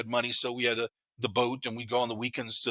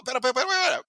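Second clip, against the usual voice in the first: placating; 11 semitones higher, faster than usual. Retold or imitated. Teen placating cop in retold story.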